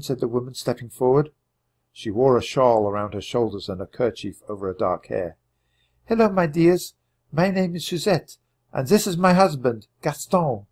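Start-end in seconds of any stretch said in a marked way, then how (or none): 6.1-10.7 high, gentle woman's voice; a French farmwife comforting hiding children; Suzette. A narrator's reading. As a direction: reassure the children as a kind mother.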